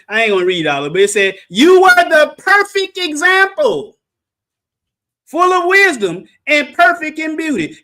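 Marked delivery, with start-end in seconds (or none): 1.5-7.8 preaching. Real, not acted. Preacher reads scripture emphatically.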